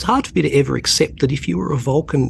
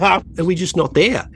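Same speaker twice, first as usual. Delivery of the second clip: higher-pitched imitation of a curious teenager; teenage son; 8 semitones higher than usual. Son asking his dad about Australians in the future, pitched-up teen imitation. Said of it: "he said".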